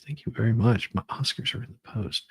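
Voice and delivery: normal talking voice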